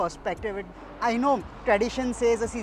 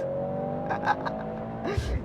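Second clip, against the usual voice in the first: short laugh; 5 dB quieter than usual. Real, not acted. Driver laughs after a nice corner.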